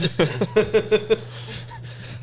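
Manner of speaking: high, drawn-out laugh; amused laughter. Real, not acted. Recalling an inside podcast joke, high laughter. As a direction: laugh hard at the memory, high-pitched.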